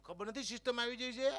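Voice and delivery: mock exclaim. Teasing the old man about system.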